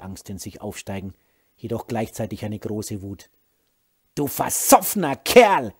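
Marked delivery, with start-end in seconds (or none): 4.1-5.7 angry yell. Acted. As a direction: shout the insult, louder and slower. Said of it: "schrie sie ihn an". (wütend angeschrien, laut und langsam)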